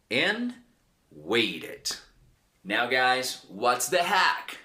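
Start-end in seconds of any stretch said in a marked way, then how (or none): 1.2-2.0 "wait it" said low with a full "it" syllable; two-syllable "wait it". Shown as a demonstration. Teacher giving example verbs, clear syllables. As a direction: add the "it" syllable after "wait". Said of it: "we have verbs like".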